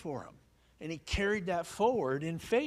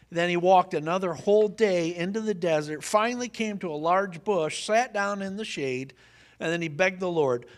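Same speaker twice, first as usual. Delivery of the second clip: higher, louder preached narration; preaching at full force; 6 semitones higher, 9 dB louder than usual. Acted. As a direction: drive the story home from the pulpit. Preaching the story loudly, higher.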